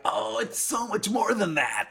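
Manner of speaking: high, drawn-out indignant wail; hammed-up exasperated protest, stretched slow; mock anger. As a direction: playfully overdo the outrage in a high voice. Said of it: "this is where I get angry".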